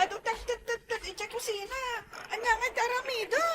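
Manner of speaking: put-on high, soft childish whine; whining child. Kid whines to daddy from the kitchen. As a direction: whimper it like a small kid.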